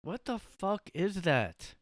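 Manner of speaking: high, slow incredulous what; disbelief. Real, not acted. Viewer reacting to the creepy clip, high and slow in disbelief. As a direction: drag it out in stunned disbelief.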